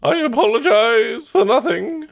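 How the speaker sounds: high, slow, loud decadent robot; Hedonism Bot. Retold or imitated. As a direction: savor every word like a decadent aristocrat. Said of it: "Can I do hedonism bot?".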